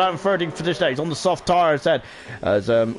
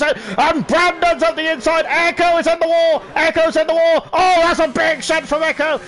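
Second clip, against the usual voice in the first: excited commentary; 6 semitones higher than usual. Real, not acted. Commentator calling a crash into the wall, excited. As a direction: sound the alarm as the car hits the wall.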